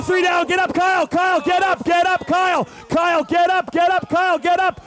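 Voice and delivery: high urgent yelling at a fallen rider; excited shout. Real, not acted. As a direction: shout him back to his feet. Rider crashes, commentator yells.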